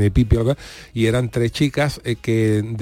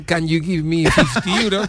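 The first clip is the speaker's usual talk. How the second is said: put-on slow, higher-pitched Spanish-accented English; comic Andalusian-accented English; 4 semitones higher, slower than usual. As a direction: show off basic English with a thick Spanish accent. Joke character shows off basic English.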